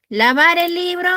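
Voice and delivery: high, incredulous rise; playful teacher rejecting a silly answer; mock surprised question. Shown as a demonstration. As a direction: ask it as if it were ridiculous. Teacher mocks an absurd answer.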